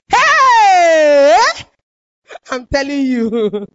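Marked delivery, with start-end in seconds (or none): 0.1-1.4 long, high scream of "hey"; loud scream. Shown as a demonstration. Woman screaming alone in her room as neighbours overhear, long loud yell. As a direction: scream it out, loud and drawn out, falling at the end. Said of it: "stay in my room sometimes and scream".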